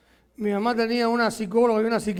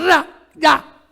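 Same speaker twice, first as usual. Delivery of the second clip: sudden, louder bark; mother's sharp shout; 4 semitones higher, 7 dB louder than usual. Retold or imitated. Mother ordering her crying son up, sharp shout. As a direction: snap it out like an angry mother.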